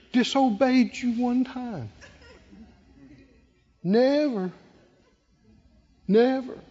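a hint of the sulky elder brother, complaining; whiny elder son. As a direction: whine it like a resentful son who feels owed. Preacher acting whiny elder son.

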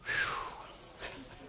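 long unvoiced blown-out exhale; breathy whew. Real, not acted. Preacher catching his breath after the climax, a soft blown whew. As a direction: let out a spent breath.

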